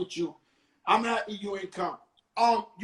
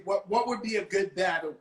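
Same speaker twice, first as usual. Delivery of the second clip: ordinary delivery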